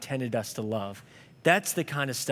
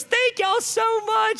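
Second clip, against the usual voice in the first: high, slow and cracking with tears; tearful thanks; 5 dB louder, slower than usual. Retold or imitated. The waitress thanking the family, high and tearful. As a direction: squeeze the thanks out through crying, pitched way up. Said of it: "And she's crying and weeping".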